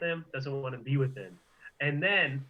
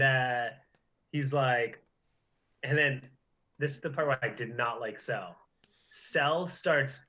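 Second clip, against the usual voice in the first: ordinary talk, nothing marked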